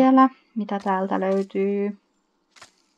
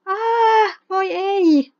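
high, loud pretend cry of distress; mock wail; 11 semitones higher, 6 dB louder, much slower than usual. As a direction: wail as the stung Sim. Sim stung by bees.

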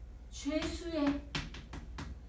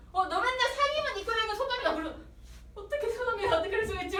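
higher, fast indignant rant; betrayed outburst; 7 semitones higher, faster than usual. Retold or imitated. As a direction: spill it all out in outrage. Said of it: "막 이러면서 막 다 불겠지".

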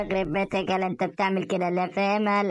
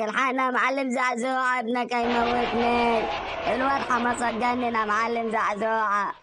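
panicked call for help; 5 semitones higher than usual. Acted. Frightened friend calls the boss for help, high and panicked. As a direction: cry out for rescue, pitched up.

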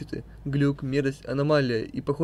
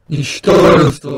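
slow, much louder furious shout; angry shout; 12 dB louder, much slower than usual. Acted. Error Sans snaps at whoever hugged him, angry shout. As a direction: spit the insult out slowly.

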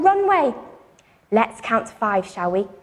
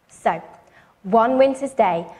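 plain reading voice

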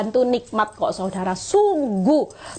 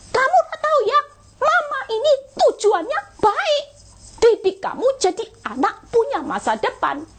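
much higher and louder scolding; shrill mock mom lecturing her kid; high, loud intonation; 13 semitones higher than usual. Mother scolding her child with raised, high voice. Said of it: "intonasimu besar, tinggi".

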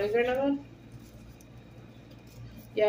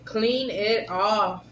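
slow insistent repeat; much slower than usual. Real, not acted. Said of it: "You gotta clean it off".

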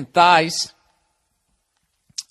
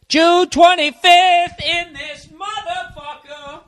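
high, slow hammed-up announcement; mock grand announcement; sing-song date; 12 semitones higher, 5 dB louder, much slower than usual. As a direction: stretch it out like a game show host. Hamming up the date.

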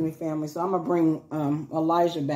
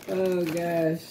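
ordinary delivery

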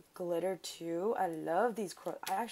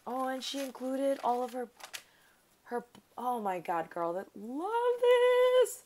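excited, building to a high loud squeal; overjoyed; 6 semitones higher, 8 dB louder than usual. Real, not acted. Crafter seeing what her friend included, overjoyed. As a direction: lose the sentence and squeal the last words long. Thrilled at finding included gifts.

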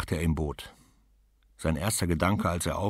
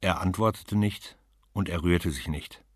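usual speaking voice

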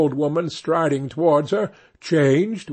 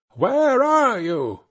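slow, much higher searching call; witch calling out; 9 semitones higher, slower than usual. A narrator's reading. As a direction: draw out the call, high and eerie. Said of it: "and cried again".

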